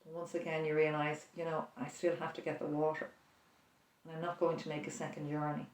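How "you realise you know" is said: ordinary delivery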